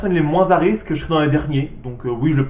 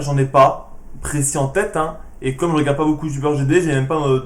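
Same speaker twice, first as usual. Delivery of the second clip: usual speaking voice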